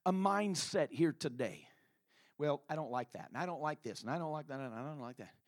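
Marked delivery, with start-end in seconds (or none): 2.4-5.3 low, soft muttered complaints; mock griping, sinking lower and quieter; grumbling critic. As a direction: imitate a fault-finder grumbling along. Voices a critical mindset.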